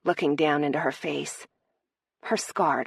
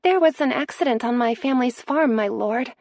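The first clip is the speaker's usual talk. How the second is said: pitched up high and a bit louder, disguising herself; a maid faking a higher girlish voice; deliberately high voice; 8 semitones higher, 5 dB louder than usual. Acted. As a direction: raise the voice high to hide who she is. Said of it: "Was her voice high enough?".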